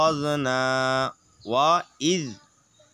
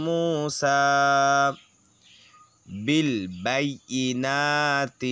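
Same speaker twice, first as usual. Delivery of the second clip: slow chanted tajweed reading; slow recited verse; Quran recitation; much slower than usual. Shown as a demonstration. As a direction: draw out جاءكم slowly like in prayer. Recites joined phrase slowly.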